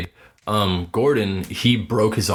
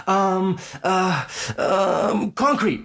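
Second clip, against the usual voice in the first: put-on much higher kid voice, stalling then guessing; hesitant kid; 9 semitones higher, much slower than usual. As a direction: hem and haw like a stumped kid.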